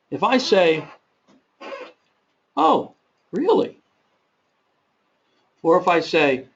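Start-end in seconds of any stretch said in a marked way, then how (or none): 2.6-3.8 "Oh, really?" slow, much higher, rising in doubt; doubtful tone. Shown as a demonstration. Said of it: "It can be one of doubt".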